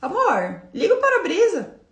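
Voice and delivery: sugary high request to the husband; forced-sweet ask for the wipers. Retold or imitated. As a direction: sweeten it after swallowing anger. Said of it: "recalcular a rota".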